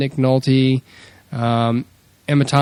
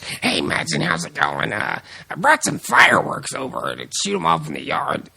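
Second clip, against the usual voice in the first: Nick Nolte impression. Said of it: "And he's like".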